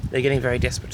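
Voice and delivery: normal talking voice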